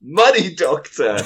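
loud, slow delighted shout; excited. Real, not acted. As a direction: exclaim it gleefully. Excited echo of joke.

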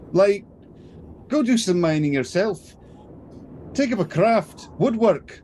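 dwarf lecturing the orcs, building exasperation; exasperated dwarf. Acted. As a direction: scold the bandits like a fed-up dwarf. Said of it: "Thoric puts up a hand and just says".

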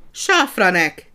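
slow, loud shout of the name; calling out. A narrator's reading. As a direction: bark his name to stop the nonsense. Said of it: "kiáltott a portásra Frici".

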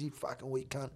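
very soft, low insult; quiet retold contempt. Retelling what he called him, hushed contempt. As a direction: drop right down to deliver the insult.

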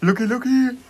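high, loud nickname call; mimicked footballer yelling for a teammate; imitated calling out. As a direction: shout it like a player on the pitch. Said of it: "Hat er rumgerufen".

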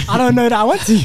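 high, loud laughing reluctance. Real, not acted. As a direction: laugh out the refusal. Laughing at the idea.

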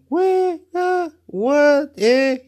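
very high, squeaky little questions; mock bafflement. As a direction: imitate baffled people in high yelps. Mimics baffled people's reactions.

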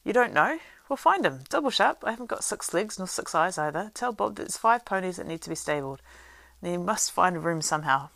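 harried landlord bossing his servant; impatient orders. A narrator's reading. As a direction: snap out orders.

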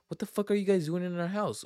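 lightly voiced angry woman; mock angry lady. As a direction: imitate an upset homeowner. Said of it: "She's like".

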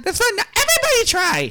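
bright, high, snappy "That's fun"; quick high muppet voice. Acted. Repeated line said two ways.

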